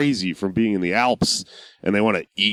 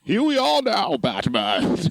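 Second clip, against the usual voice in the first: much higher, far from own voice; masked villain growling at his foe; Bane impression; 10 semitones higher, slower than usual. Villain welcoming Batman to a park, Bane voice. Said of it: "I just always do Bane".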